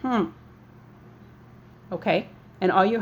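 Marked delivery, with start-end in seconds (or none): 0.0-0.3 word hummed with lips shut; one short hummed syllable; closed-mouth hum. Shown as a demonstration. Teacher shows parents how heart sounds with mouth shut, closed-mouth hum. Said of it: "close your mouth and say heart".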